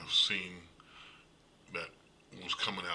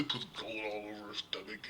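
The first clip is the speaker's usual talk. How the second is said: laughing through the words; amused, laugh spilling into the line; 5 dB louder than usual. Real, not acted. Laughing at absurd movie scene.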